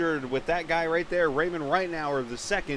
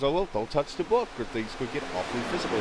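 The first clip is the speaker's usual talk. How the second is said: dry coach voice; 5 semitones lower, 3 dB quieter, faster than usual. Retold or imitated. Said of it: "He had, like, that dry tone voice".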